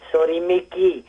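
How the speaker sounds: put-on light South African accent on the apology; a hint of South African, cheeky; mild South African voice.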